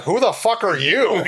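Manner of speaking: high, laughing retort; laughing mock comeback. Retelling meeting Ben, laughing mock comeback. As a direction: fire it back while cracking up. Retold snapping back, laughing.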